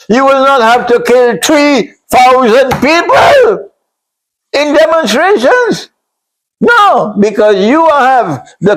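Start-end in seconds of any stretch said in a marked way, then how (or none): 0.1-7.1 raised, high-pitched outcry; real preacher's outburst at full pitch; indignant shout. Real, not acted. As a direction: shout it in protest, voice climbing high. Indignant rebuke building to a shout.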